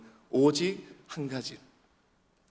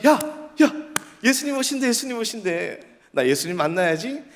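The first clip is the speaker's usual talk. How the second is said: lightly acted excited onlooker, higher pitched; excited crowd; 9 semitones higher than usual. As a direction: voice an eager crowd member. Voices the eager crowd.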